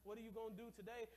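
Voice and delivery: ordinary talk, nothing marked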